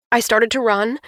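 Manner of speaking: plain reading voice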